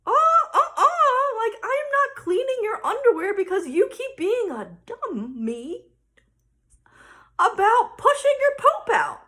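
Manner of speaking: high, loud, hammed-up mom protest; indignant. Mom reacting to her daughter's poop logic, hammed-up indignation. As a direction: splutter into an outraged refusal.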